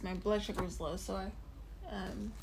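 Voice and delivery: candy in the mouth; chewing a Jolly Rancher; mouth full. Real, not acted. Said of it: "Sorry, I'm chewing on this".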